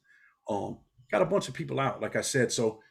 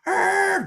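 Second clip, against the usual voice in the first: yelled ah; 6 dB louder than usual. Retold or imitated. Driver's parting yell.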